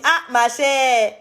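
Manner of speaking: put-on a light, joking Ivorian accent. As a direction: let the Ivorian accent slip out teasingly. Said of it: "accent ivoirien est sorti".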